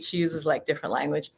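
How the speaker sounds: ordinary talk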